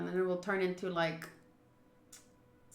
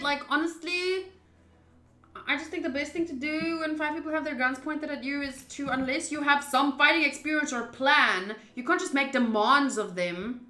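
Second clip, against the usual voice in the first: urgent pleading; 5 semitones higher, 6 dB louder than usual. Real, not acted. Urging the character to comply.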